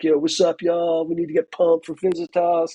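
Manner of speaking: hammed-up student hype; pumped-up freshman. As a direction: imitate an overeager kid in a group chat. Mimics eager freshmen's group chat texts.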